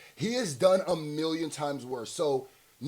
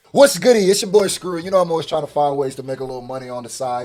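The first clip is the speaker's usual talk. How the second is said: upbeat sponsor pitch, louder and punchy; ad read; 8 dB louder than usual. Acted. Streamer pitching app to his viewers, upbeat ad read. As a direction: sell it to the viewers like a hype ad.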